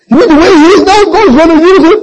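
loud, very high mock wail; mock crying. Sister protesting her father's salvation to her pastor, mock crying. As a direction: wail in disbelief, like a tearful complainer. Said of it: "she came and she's crying".

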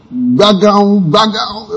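high mouth-made bell clang; vocal bell sound effect; imitated bell ringing. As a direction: ring out like a bell with your voice. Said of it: "you ring the bell".

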